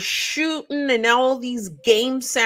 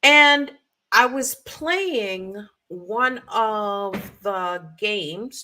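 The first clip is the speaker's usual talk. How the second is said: usual speaking voice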